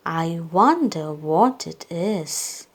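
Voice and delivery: ordinary delivery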